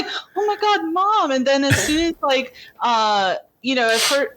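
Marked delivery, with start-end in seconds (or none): 0.4-1.3 high-pitched retold shock; mock alarm. Viewer reacting to the film's mother, high mock alarm. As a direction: imitate a startled cry, pitched well up.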